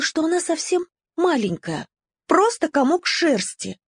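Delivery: ordinary delivery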